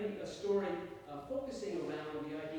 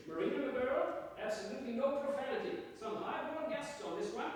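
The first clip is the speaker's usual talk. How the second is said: higher-pitched, admonishing British captain; brusque British accent; 10 semitones higher, 4 dB louder than usual. Acted. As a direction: scold the bird in a brisk British accent. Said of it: "admonishing in that brusque British accent of his".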